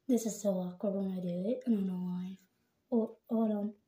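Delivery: talking while brushing teeth; toothbrush in mouth. Real, not acted. Seen 0: toothbrush in mouth throughout labelled words.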